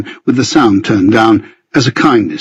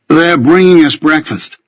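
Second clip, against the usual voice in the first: lightly bent Minnesotan vowels, higher pitch; Minnesotan accent; 5 semitones higher than usual. A narrator's reading. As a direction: put on a slight Minnesota accent. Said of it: "bending my Minnesotan vowels this time".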